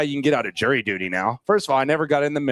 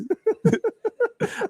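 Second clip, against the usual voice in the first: high laugh. Real, not acted. Laughing at own joke.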